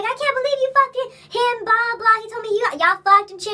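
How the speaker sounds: high-pitched imitation of an outraged friend; mock accusation. Storyteller imitates ex-best friend confronting her, mock angry accusation.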